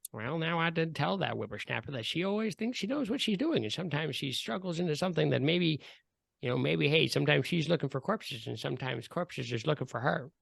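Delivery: a lightly voiced old man, grumbling on; old man muttering. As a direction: mutter it like a cranky old codger. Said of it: "you hear this old man mutter".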